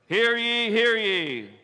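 loud, ringing town crier call; herald's cry. Acted. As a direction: proclaim it like a herald in the square. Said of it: "Proclaim was the phrase that we use for a herald".

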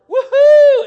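high, loud woohoo cheer; joyful whoop. Retold or imitated. The healed man jumping for joy, a big high whoop. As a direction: let out a huge delighted whoop.

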